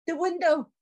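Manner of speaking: high-pitched staged amazement; mock surprise. Actress spotting someone in the window, mock surprise. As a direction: act astonished, pitched well up.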